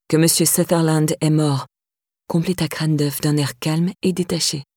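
plain reading voice

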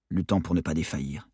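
ordinary delivery

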